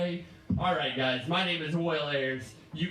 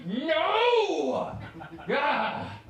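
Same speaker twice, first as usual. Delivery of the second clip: mock anguished cry; 10 semitones higher, 4 dB louder than usual. Mock anguish over ruined movies.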